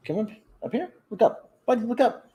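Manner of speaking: high sing-song coaxing; coaxing pet talk. Real, not acted. Host coaxes cat to look at camera, high and cooing. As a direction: coax the cat up in a high coo.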